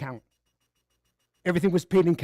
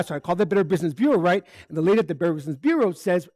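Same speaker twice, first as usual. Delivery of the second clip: ordinary delivery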